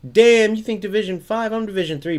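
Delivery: high, loud, offended brag; mock indignant. Opponent scoffing at the streamer's guess, mock indignant. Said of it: "You know, like".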